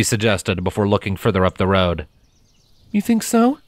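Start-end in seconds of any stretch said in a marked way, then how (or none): 2.9-3.6 higher character voice, lightly amused; Scarlet, amused. A narrator's reading. Scarlet thanks Primrose after laughing, amused character voice. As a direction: thank the filly with a smile in the voice.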